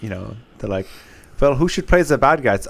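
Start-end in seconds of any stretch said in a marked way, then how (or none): 1.4-2.7 imitating a German comedian; matter-of-fact German-accented bit; put-on German accent. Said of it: "like, Germans and German cinema".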